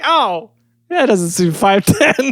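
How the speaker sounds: high, slow, doubtful newsman; mock skeptical reporter. Mocking the news crew seeing the boy, slow high skepticism. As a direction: drag it out in disbelief. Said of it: "they get him out and they're like".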